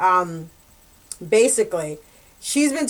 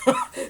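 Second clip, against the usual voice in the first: short real laugh. Real, not acted. Laughs at own rant.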